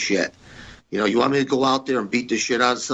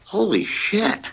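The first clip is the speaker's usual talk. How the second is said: mock shock; 11 semitones higher, slower than usual. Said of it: "I'm like".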